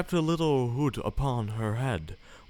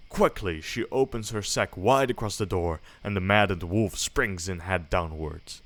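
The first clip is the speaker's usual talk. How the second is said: usual speaking voice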